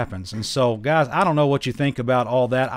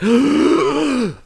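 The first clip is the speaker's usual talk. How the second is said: mock shocked gasp; 13 semitones higher, 4 dB louder than usual. Imitating shocked believers.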